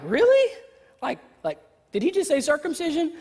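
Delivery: high squeak of disbelief on really; mock shock at the word circumcision. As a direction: react like you can't believe it. Shocked reader of text.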